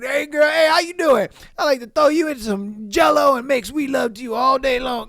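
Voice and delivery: hammed-up street come-on, pitched high; imitating bold homeless man. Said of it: "He was just like".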